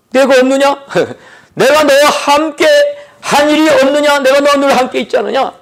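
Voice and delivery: God's voice. Retold or imitated. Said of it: "하나님의 음성이 들리지요".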